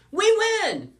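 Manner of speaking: very high, very loud yell; victory shout. A narrator's reading. Player after the team won the tournament, victory shout. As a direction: shout the win at full force. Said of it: "Ryder shouted".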